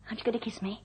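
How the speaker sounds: flirty whisper. Acted. Secretary flirting with her boss.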